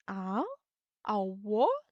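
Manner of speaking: "Ah?" and "what?" swooping upward; mock puzzlement, pitch climbing on each word; confused, surprised question. Shown as a demonstration. Teacher shows a confused English question, rising in surprise. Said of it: "you are confused or surprised".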